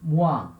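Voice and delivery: "one" said as French oin, nasal; slow French model word; French pronunciation. Shown as a demonstration. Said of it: "O I N vai ser".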